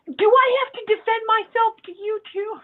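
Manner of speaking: loud, high-pitched exasperation; indignant retort. Acted. Upset worker to questioning friend, indignant outburst. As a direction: protest being questioned by a friend.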